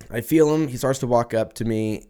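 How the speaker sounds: normal talking voice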